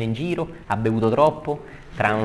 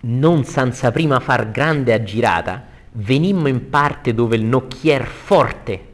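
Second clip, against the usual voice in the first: slow, projected poetry reading; verse recitation; 5 dB louder, slower than usual. Acted. Teacher reading the ferryman's arrival, slow declamation. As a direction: declaim with long stressed vowels. Reads Dante's verse aloud.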